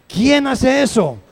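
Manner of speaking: loud slow rhetorical question; emphatic repeat. Acted. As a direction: challenge the congregation.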